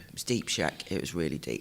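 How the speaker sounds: low, soft imitation of Donkey saying it; mock-deep Donkey line from Shrek; Donkey quote. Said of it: "That's what Donkey says".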